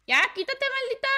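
loud, high, annoyed outburst; exasperated yell. Real, not acted. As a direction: tell off a pesky opponent loudly. Snapping at an opponent in game. (grito agudo de fastidio)

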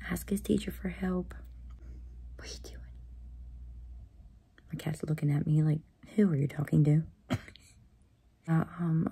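hushed late night whisper. Real, not acted. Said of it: "So I have to whisper".

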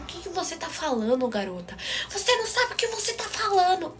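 imitated mother, pitch climbing high into an outraged snap; scolding mother. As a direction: play mom losing her composure, rising to a shriek. Mother scolds daughter, retold.

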